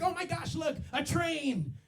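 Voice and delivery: mock delight at spotting a train; excited girlfriend. Girlfriend interrupting a serious talk, mock excited. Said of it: "she'll be like".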